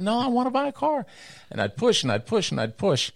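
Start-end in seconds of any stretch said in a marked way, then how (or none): 0.0-1.1 put-on much higher, pleading child voice; insistent kid. Eleven-year-old insisting to his dad, high pleading kid voice. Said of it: "I said".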